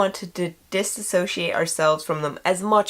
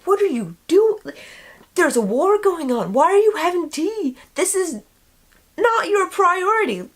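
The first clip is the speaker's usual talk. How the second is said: exasperated Americans; 11 semitones higher than usual. Retold or imitated. Americans scolding the British over tea, in mock exasperation. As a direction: play the fed-up American soldier, half-joking. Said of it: "And we were like".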